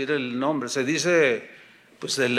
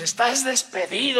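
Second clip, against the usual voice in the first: higher-pitched mock denunciation; accusing pastor; 7 semitones higher than usual. Pastor dismissing a member before the congregation, denouncing. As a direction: expel him in front of everyone.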